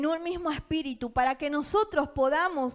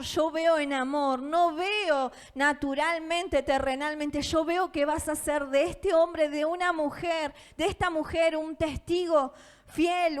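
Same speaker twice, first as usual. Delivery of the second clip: ordinary delivery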